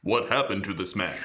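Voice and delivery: put-on low-pitched French accent; best French accent. Player questions a Russian in game, low French accent. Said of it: "my best French accent".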